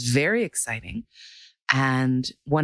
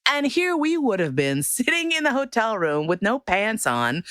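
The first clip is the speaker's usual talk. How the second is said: ordinary talk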